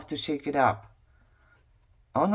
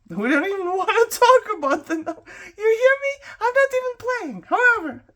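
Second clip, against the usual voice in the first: laughing squeal; 14 semitones higher, 4 dB louder than usual. Real, not acted.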